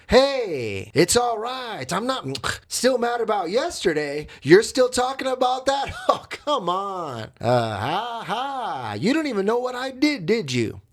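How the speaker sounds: high, sing-song fake reassurance; sarcastic. Cheater to girlfriend about yesterday's argument, gloating sarcasm. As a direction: act breezily forgiving with mock glee. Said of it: "I'm being sarcastic the way I'm saying it".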